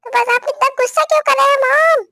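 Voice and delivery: whiny protest. Acted. Child protests to scolding mom.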